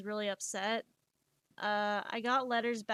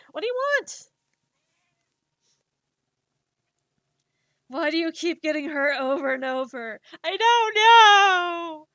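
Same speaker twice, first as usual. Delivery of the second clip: mock sob; 5 semitones higher, 10 dB louder than usual. Self-dialogue, mock crying.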